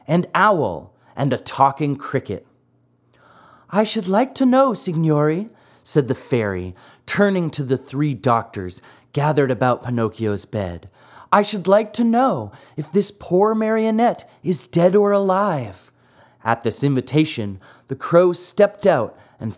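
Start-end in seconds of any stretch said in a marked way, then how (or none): 3.7-5.5 high fairy voice. Acted. Fairy asks the doctors. 11.3-15.9 high fairy voice. Acted. Fairy asks the doctors.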